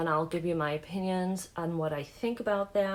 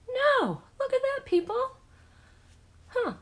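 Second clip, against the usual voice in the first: surprised; 5 dB louder than usual. Real, not acted. Foundation looks better than expected.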